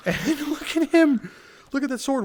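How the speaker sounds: cracking up at the enemy's sword; laughing. Real, not acted. Gamer laughing at an enemy's oversized sword, high amused.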